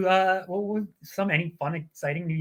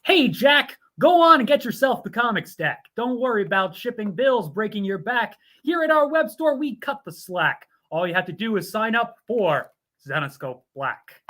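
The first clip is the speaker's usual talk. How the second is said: louder, punchy rhyming pitch; rhyming ad read; 4 semitones higher, 4 dB louder than usual. Acted. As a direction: sell it like a jingle without music.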